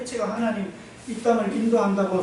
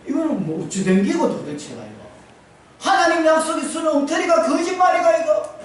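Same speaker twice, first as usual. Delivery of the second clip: rising to a high, loud indignant cry; the general cries out that God's promise was a lie; general's outraged protest; 10 semitones higher, 7 dB louder than usual. Retold or imitated. Preacher voicing the general's outrage at God, high and loud.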